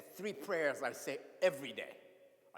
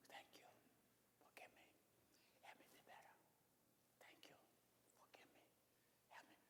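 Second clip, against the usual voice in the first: breathy whisper, fading to nothing; whispered prayer; 28 dB quieter than usual. Shown as a demonstration. Preacher demonstrating the whispered prayer, whispering. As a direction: whisper it like a secret to God. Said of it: "You can whisper it".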